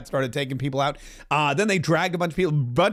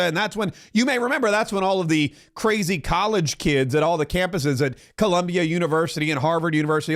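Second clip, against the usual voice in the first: ordinary delivery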